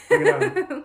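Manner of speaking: very high, loud whoop; shrieked playful dare; mock challenge. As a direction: taunt like an over-eager contestant.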